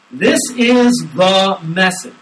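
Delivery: slow, loud, weighty scripture read; emphatic reading. Shown as a demonstration. Pastor reading the verse to the congregation, emphatic and slow. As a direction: lean hard on every word of the verse. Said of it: "Notice how emphatic he is".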